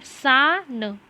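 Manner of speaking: सा held long, then a separate न; broken into syllables. Shown as a demonstration. Teacher helps weak readers, sounding out syllables slowly. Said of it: "थोड़ा थोड़ा short short करके".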